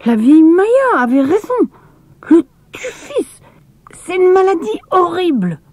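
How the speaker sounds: put-on high, bright child voice, half alarmed; a boy spooking himself with a grim diagnosis; voiced boy Emil. Emil tells the family about typhus, high child voice. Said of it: "fit Émile".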